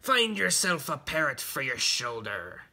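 commanding pirate character voice; pirate captain. Acted. Pirate captain ordering his son.

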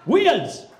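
high, loud Welsh accent; loud, falling Welsh-accented shout. Shown as a demonstration. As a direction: call it out high in a Welsh lilt. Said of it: "the Indians migrated to Wales".

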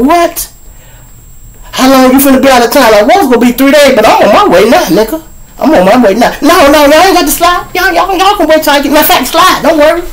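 high-pitched, agitated back-and-forth; high, urgent protesting; urgent acted exchange. Acted urgent call to absent friend.

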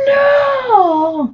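very high, crying shout; all shout no. A narrator's reading. Toute la famille crie non ensemble, très aigu. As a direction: bellow it together. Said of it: "crient tous ensemble".

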